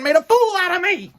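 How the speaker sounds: ordinary delivery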